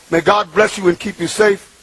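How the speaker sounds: ordinary talk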